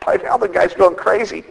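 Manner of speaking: normal talking voice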